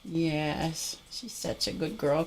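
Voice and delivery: very quiet praise; soft praise. Real, not acted. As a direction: murmur to the dog. Praising dog softly.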